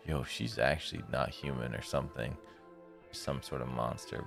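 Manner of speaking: ordinary talk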